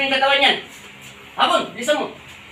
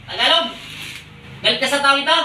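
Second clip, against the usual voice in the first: angry questioning. Real, not acted. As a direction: press the spirit hard. Healer interrogates spirit. (galit na tanong)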